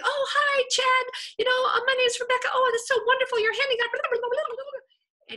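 high, loud, breathless flood of chatter; gushing a mile a minute. Retold or imitated. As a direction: babble at him nonstop with excitement. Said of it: "I'm talking miles an hour".

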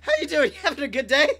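loud, panting, high delivery guy greeting; out of breath. Retold or imitated. Breathless pizza guy huffing at the customer's door. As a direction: gasp the words out like you just sprinted. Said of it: "out of breath".

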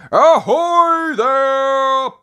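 long, high, loud sailor's hail; calling out. A narrator's reading. Grampy Rabbit hails the stranded sailors, calling out. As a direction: holler it across the water. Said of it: "he calls".

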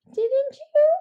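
high, loud surprised squeak. Acted. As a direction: sound genuinely taken aback. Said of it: "said Stuart the Badger, surprised".

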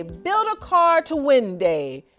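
plain reading voice

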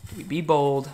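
usual speaking voice

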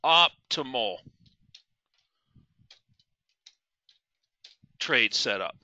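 Optimal and trade setup said slowly, each part set apart by long pauses; emphasized phrase. Shown as a demonstration. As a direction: lean on Optimal, pause, then a low, slow trade setup. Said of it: "I wanna really emphasize this word".

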